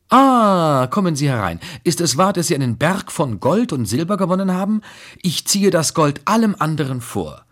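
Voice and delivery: high-pitched teasing lady of the house; flirty Blanche. A narrator's reading. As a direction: call him in, gushing and coquettish. Narrator voices Blanche.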